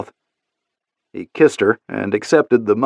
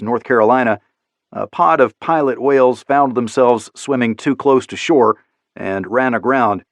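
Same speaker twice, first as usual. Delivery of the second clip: ordinary delivery, nothing marked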